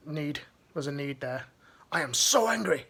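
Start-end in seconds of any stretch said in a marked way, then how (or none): 1.9-2.9 mock angry post. Voicing an angry Facebook status.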